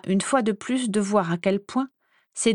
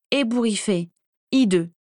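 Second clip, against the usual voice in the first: ordinary delivery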